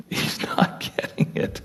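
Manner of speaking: soft whispered repeat with a laugh. Shown as a demonstration. Preacher whispering to the congregation about Job, a rueful whisper. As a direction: breathe it out as a rueful aside.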